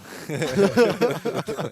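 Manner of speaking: bursts out laughing loudly; a big loud real laugh. Real, not acted. Host laughs at humility joke.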